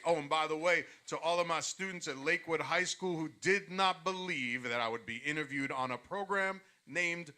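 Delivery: loud announcer voice, building to a shout; ring announcer. Shown as a demonstration. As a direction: boom it out to the whole arena. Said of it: "We want the intro".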